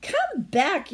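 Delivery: calling out. Acted. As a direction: call after the running hedgehog. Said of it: "she called".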